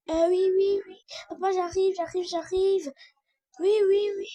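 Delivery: high, loud, repeated replies; play-acted flustered reply, pitched high; hurried answering shout. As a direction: shout back that you're on the way.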